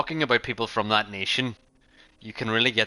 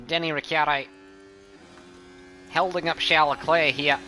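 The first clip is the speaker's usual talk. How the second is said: put-on lightly done Australian commentator accent, pitched higher; a hint of an Australian commentary voice; Aussie accent; 5 semitones higher than usual. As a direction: play an Australian race commentator calling the action. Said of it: "Was that a good enough Aussie accent?".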